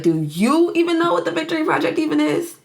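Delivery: mocking retort, pitched high from YOU on; shooting back. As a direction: throw the question back, leaning on "you". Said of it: "Frank shoots back at her".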